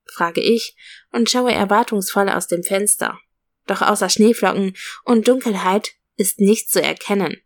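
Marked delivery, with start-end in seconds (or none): none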